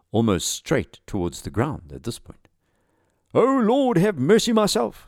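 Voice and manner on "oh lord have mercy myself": loud, very high panicked cry; acted high scream of desperation. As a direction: scream it as the doomed pilot. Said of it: "screamed Asker".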